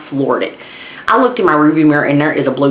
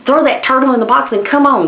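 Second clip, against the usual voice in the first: impatient command; 8 semitones higher than usual. Retold or imitated. Urging friend.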